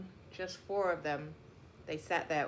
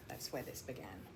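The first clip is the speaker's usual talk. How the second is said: ordinary delivery, nothing marked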